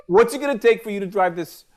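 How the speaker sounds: ordinary talk, nothing marked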